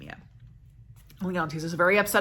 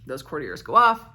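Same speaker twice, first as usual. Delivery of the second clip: ordinary delivery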